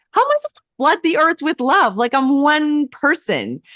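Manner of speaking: high, incredulous pitch rising on every word. Retold or imitated. Retelling a divine assignment, high disbelieving squeal. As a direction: retell the panic of an impossible task. Overwhelmed by being told to flood earth.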